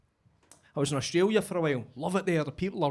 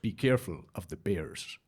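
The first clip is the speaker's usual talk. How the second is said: put-on Russian accent; 9 semitones lower, 3 dB quieter than usual. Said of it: "he went".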